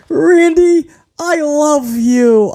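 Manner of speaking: high, slow swooning impression; mock Riddle. Repeating Riddle's line to viewers, mock lovesick. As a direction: drag it out lovesick.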